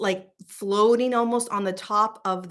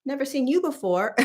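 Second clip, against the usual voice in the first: friendly manatee voice; 8 semitones higher than usual. Pretend. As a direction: voice the curious manatee saying hi. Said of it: "was like".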